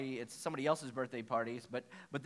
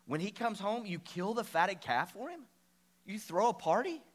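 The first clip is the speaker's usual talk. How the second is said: mild retold anger; 5 semitones higher than usual. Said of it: "the older brother became angry".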